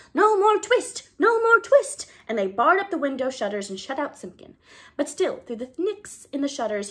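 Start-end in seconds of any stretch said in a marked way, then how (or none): 0.1-2.1 very high, loud twittering shout; squeaky mice shouting the cat away. Acted. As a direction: shout it as tiny mice all at once. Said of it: "all began to shout at once in little twittering voices".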